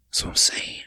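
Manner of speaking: breathy whisper of agreement. Real, not acted. Host whispering agreement to his co-host. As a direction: whisper it like a secret.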